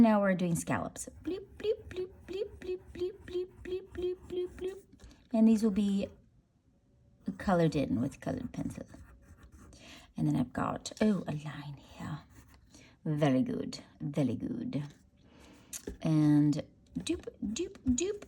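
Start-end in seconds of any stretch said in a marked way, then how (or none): 1.2-4.8 sound effects. Pretend. Sound effects while drawing scallops. 11.0-14.8 whisper. Real, not acted. Noticing a line while drawing. 17.5-18.2 sound effects. Pretend. Sound effects while drawing.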